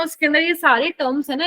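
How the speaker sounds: ordinary delivery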